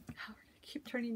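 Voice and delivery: unvoiced whisper while moving the camera; hushed aside, whispered fast. Real, not acted. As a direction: whisper it, don't disturb anyone. Quietly turning camera in room.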